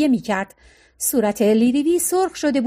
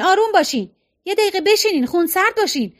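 higher, insistent settling-down commands; urgent calming orders; 7 semitones higher than usual. A narrator's reading. Ira telling the family to sit down, insistent. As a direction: press everyone to calm down.

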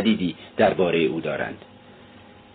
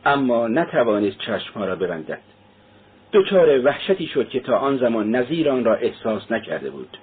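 ordinary delivery

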